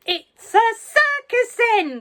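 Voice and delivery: high, loud, slow delighted shout. A narrator's reading. Grandma shouting from the rooftop, delighted. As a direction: holler it from the rooftop. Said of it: "Grandma shouted from the rooftop".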